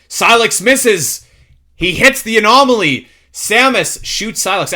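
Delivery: loud, high, slow blow-by-blow; dramatic play-by-play. Real, not acted. Host calls the big stare down, loud dramatic play-by-play. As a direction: call each action big and drawn out.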